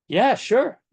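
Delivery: quick hammed-up agreement; agreeable listener. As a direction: play the willing listener, pitched well up. Voices the listener agreeing to hear.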